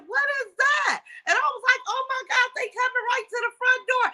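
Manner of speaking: high, shouted panic retold; panicked. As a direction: cry out in alarm at the camera. Retelling panic at night cameras.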